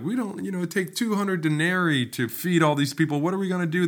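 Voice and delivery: high, frantic mock panic; retelling the disciples' panic over feeding the crowd, high and frantic; panicking disciples. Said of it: "They are panicking".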